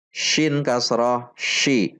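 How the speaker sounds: usual speaking voice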